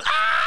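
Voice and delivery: high shrill mock scream; a kid's exaggerated scream over a scrape. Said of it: "freak out screaming".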